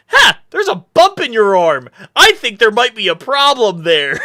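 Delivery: high, bright exclamation of discovery; mock alarm. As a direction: blurt it out like it's a fun find. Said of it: "I was like".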